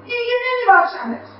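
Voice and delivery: high, slow, firm refusal; bitter refusal. Acted. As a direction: spit out the refusal slowly. Mother refuses to forgive.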